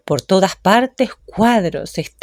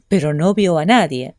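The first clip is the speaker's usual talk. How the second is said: plain reading voice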